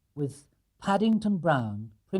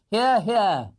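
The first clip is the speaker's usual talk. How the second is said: louder, higher approving call; louder hear hear; hearty agreement; 7 semitones higher, 7 dB louder than usual. Acted. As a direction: back the toast. Mr Brown agrees.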